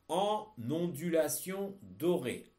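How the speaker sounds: slow, loud and distinct dictation; dictating. Shown as a demonstration. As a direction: read each word out for pupils to copy. Teacher dictating text to pupils.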